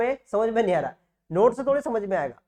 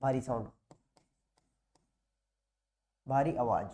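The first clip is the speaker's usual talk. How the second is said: heavy voice; 8 semitones lower, 8 dB quieter than usual. Shown as a demonstration. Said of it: "यानी भारी".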